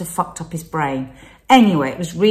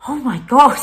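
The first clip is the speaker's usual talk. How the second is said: daughters' shock; 7 semitones higher, 6 dB louder than usual. Retold or imitated. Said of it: "they went".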